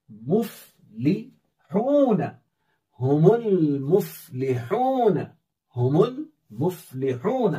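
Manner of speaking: हुमुल मुफ़्लिहून drawn out and chanted; melodic, careful read of हुमुल मुफ़्लिहून; slow Quranic recitation. Shown as a demonstration. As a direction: recite मुफ़्लिहून slowly with a long ऊ. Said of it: "इसे आप खुद पढ़ लें".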